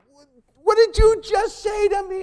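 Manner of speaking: high and loud, in a different voice; disbelief, as if insulted; shocked prisoner. Retold or imitated. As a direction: prisoner stunned by the preacher's words. Prisoner reacts to preacher.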